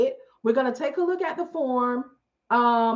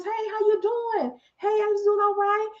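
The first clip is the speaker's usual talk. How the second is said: greeting churchgoers; 6 semitones higher than usual. Retold or imitated.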